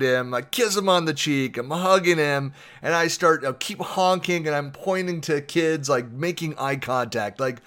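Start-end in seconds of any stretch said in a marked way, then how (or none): none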